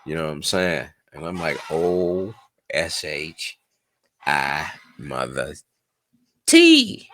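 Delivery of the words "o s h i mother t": spelled out slowly. Retold or imitated. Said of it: "spelled out slowly".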